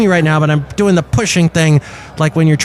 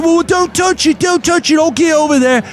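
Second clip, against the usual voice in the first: upset passenger; 11 semitones higher than usual. Retold or imitated. Angry passenger quoted.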